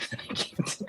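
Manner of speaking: breathless whisper after laughing. Real, not acted. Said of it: "I really tried".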